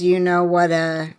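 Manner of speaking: ordinary delivery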